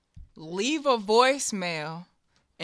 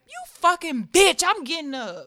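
mock yell; 8 semitones higher, 5 dB louder than usual. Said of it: "I'll be like".

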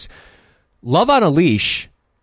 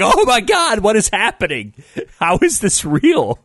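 high, laughing, incredulous; mock disbelief; 7 semitones higher than usual. Reacting to the absurd movie line, high incredulous laugh. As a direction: squeal in stunned amusement. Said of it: "I was just like".

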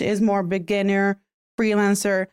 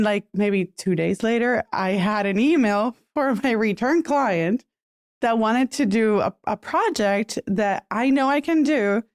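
usual speaking voice

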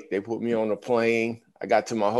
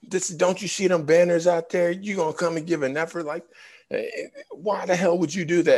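higher, heated scolding; angry chewing out; 8 semitones higher than usual. Retold or imitated. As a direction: lay into the rookie like furious veterans. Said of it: "they're going in on me like".

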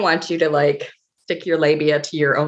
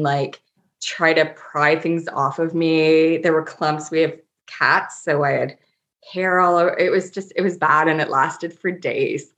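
normal talking voice

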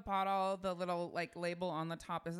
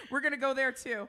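normal talking voice

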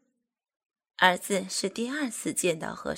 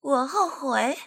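scornful disbelief; 6 semitones higher, 4 dB louder, slower than usual. Acted. Scornful retort.

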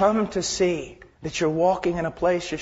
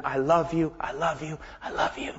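hushed, affectionate reassurance; tender declaration; 2 semitones lower, 4 dB quieter than usual. Acted. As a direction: repeat it ever more softly and lovingly. God's repeated love, fading away.

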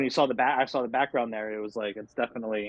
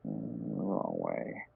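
very low, slow, drawn-out wrong way; deep slow voice; 11 semitones lower, 10 dB quieter, much slower than usual. Acted. Fumbling a card, muttered to self.

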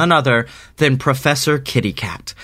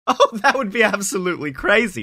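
laughing; 7 semitones higher, faster than usual. Acted. Character laughing at joke.

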